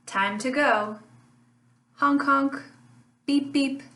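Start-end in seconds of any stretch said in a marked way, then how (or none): none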